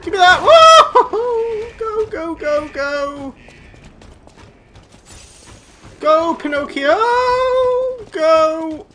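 high, loud frantic yelling; frantic shouting. Real, not acted. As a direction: yell at the screen in a panic. Frantic chase, yelling at screen.

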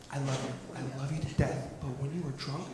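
very soft, low, tender aside; hushed, low declaration of love; mock-sincere confession. Real, not acted. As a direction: drop low and hushed, confiding.

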